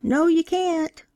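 put-on a child's high shout back, drawn out slowly; child shouting the answer in a church service. A narrator's reading. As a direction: call it out high like a schoolkid answering the preacher. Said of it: "One of the school children shouted back".

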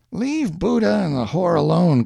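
high-pitched street tough; taunting neighbour. A narrator's reading. Hank's parting taunt in quarrel.

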